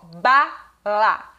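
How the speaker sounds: "bala" very slow, as BA... LA; syllable by syllable. Shown as a demonstration. Teacher showing parents what syllables are, syllable by syllable. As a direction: split bala into two separate, stretched syllables. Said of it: "que são as sílabas".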